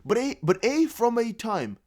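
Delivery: slow, deliberate, baffled retry; slow puzzled reread. Shown as a demonstration. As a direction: spell it out slowly in disbelief. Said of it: "but what?".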